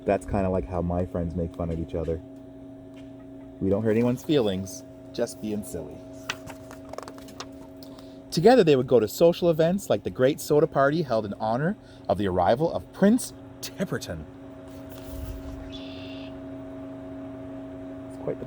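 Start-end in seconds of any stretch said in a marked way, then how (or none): none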